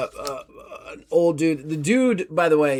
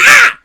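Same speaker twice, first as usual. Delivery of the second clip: sudden high squeal of fright; imp's startled shriek; 16 dB louder than usual. Acted. GM voicing the imp as it ducks behind the stairs, startled shriek. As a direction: shriek and duck like a scared imp. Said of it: "The imp's like".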